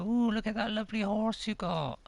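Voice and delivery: a touch of put-on admiration; lightly imitated Jess. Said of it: "Jess is gonna go".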